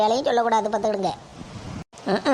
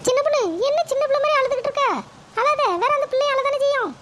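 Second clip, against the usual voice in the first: put-on much higher young voice, quick and teasing; mocking daughter-in-law; 14 semitones higher than usual. Daughter-in-law mocking the crying sister-in-law, high and fast. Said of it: "மருமகளே, போய் coffee கொண்டு வாமா".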